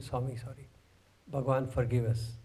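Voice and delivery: low, soft plea for forgiveness; retold tearful apology. Band boys catching hold of Swami to apologise, subdued retold plea. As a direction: beg pardon quietly. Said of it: "We were crying".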